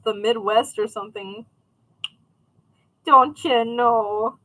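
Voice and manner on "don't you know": slow, light Midwestern accent. Shown as a demonstration. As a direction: drawl it out in a mock Midwest accent. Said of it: "get, like, a accent right there, like, from the Midwest".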